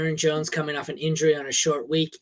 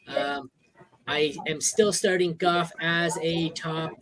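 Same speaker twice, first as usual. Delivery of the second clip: usual speaking voice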